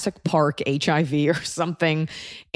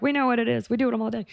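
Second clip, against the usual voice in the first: mock nurses; 8 semitones higher, faster than usual. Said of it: "all the nurses listening are like".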